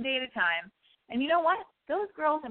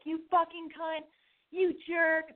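retold yell; 7 semitones higher, slower than usual. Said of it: "the dudes are yelling at me".

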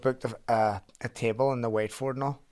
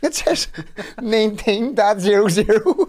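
high, loud, slow reading out of the time; a friend yelling the departure time; retold exasperated shout; 10 semitones higher, 10 dB louder than usual. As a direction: spell it out furious and slow.

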